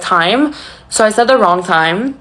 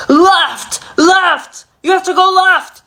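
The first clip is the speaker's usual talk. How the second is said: yelling directions at her, much higher pitch; retold scream; 10 semitones higher, slower than usual. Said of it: "he starts screaming".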